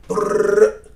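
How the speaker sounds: rolled-lip brrr of a rifle burst; spray the room with your mouth; gunfire noise. Retold or imitated. Reactor mimicking the soldier's gun going off, a loud rolled brrr.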